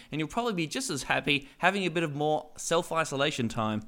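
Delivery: usual speaking voice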